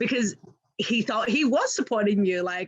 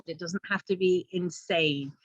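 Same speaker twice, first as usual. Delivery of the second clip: ordinary delivery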